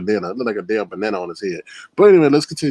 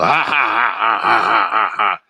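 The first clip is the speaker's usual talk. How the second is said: big burst of real laughter; cracking up at the joke; loud laugh; 2 semitones lower, 6 dB louder than usual. Real, not acted. Laughing hard at the roast joke.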